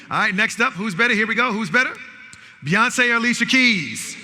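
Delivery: loud, high call-and-response hype; game announcer. Real, not acted. Host calling out music match-ups to the audience, loud announcer hype. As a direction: fire up the crowd to shout back.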